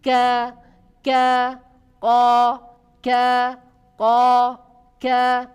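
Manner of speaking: ka and ko said thin and light, each loud and separate; light kaf drill. Shown as a demonstration. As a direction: keep ka and ko thin, a clear demo. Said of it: "Tapi kalau kaf tipis".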